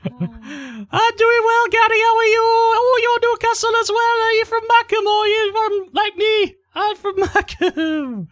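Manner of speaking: falsetto Geordie chat, very high; [name]. Pretend. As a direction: chat away as a put-on Newcastle woman. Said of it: "[name]'s from Newcastle right now".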